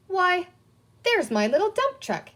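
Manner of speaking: light up as you spot it; a child finding his own lost toy; surprised delight. A narrator's reading. Peter spots his lost truck.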